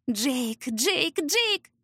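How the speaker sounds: rising, sugary, repeated name; sweet voice. Acted. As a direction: coo his name ever sweeter. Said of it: "повторяет сладким голосом".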